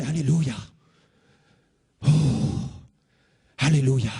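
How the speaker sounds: lost in ecstasy, murmuring praise; ecstatic alleluia. Retold or imitated. Young man in trance answering the pastor, rapt.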